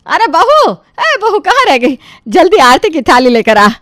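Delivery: high, impatient call for her; shouted call. Acted. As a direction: yell for the daughter-in-law to hurry. Mother-in-law summons daughter-in-law again. (बहू को ऊँचे सुर में पुकारना)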